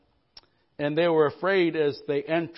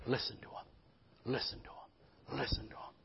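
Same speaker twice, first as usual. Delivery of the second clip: soft, breathy, almost unvoiced whisper; whispered repetition; 4 semitones lower, 14 dB quieter than usual. Pastor urging the church to listen, whispered. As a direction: whisper it like a secret to the heart. Repeating the command in a hush.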